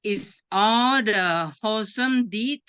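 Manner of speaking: ordinary talk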